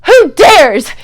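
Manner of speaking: loud, high, hoarse royal fury; furious demand. Acted. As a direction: bark it at the courtiers, outraged. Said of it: "he demanded hoarsely".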